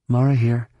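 ordinary delivery

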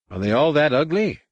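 it slips out before he can stop it; blurted. A narrator's reading. Anakin blurts his first reaction to the newborn. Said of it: "he blurted".

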